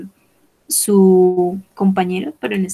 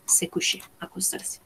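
soft aside; 11 dB quieter than usual. Shown as a demonstration. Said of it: "Se coucher es acostarse".

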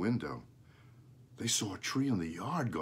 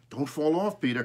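cried out; 6 semitones higher, 5 dB louder than usual. A narrator's reading. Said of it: "cried his father".

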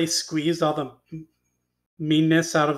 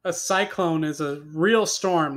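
ordinary delivery

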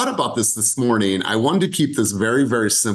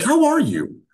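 concerned, high; 14 semitones higher than usual. Shown as a demonstration. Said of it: "that person's really concerned about me".

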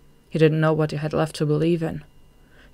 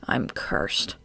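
despairing; 8 semitones lower, 4 dB quieter than usual. A narrator's reading. Despairing end of her outburst.

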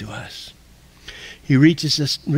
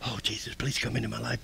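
furtive, very soft mutter, lower; secretive prayer; 4 semitones lower, 14 dB quieter, faster than usual. Acted. Acting someone who doesn't want to be overheard praying, hushed. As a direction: pray it so nobody else can hear. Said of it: "I don't want anybody else to hear me say".

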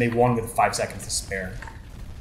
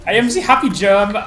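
cheery mock German; 8 semitones higher, 8 dB louder than usual. Playing happy German character.

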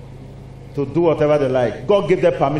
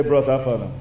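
prince voice; 6 semitones lower than usual. Acted. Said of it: "The prince can say".

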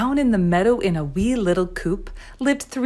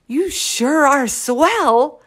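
slow, high, admiring chick voice; naive chicks; 6 semitones higher, much slower than usual. A narrator's reading. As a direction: gush slowly like a little chick. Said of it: "said the chickies".

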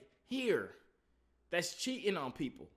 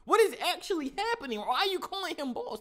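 disbelieving; 9 semitones higher, 7 dB louder than usual. Real, not acted. Disbelief at the video.

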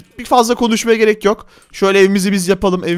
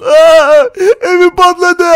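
high, loud hammed-up crying; wailing in tears; 7 semitones higher, 5 dB louder, much slower than usual. Player mourning his exploded house, wailing in tears. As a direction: bawl the words out, then sob. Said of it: "Ağlama oğlum, ağlama".

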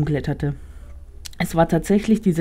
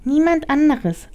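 high, slow little rat; 9 semitones higher than usual. Acted. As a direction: answer as a tiny rat. Rat answers Emily.

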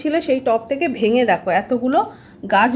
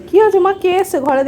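higher, louder coo to a pet; 6 semitones higher, 4 dB louder than usual. Real, not acted. Mother cooing to her pet monkey, higher and louder.

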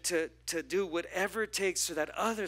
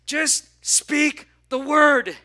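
loud, high, very slow declaration; slow commanding call; 8 semitones higher, 11 dB louder, much slower than usual. Acted. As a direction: drag out each word with force. Centurion commands.

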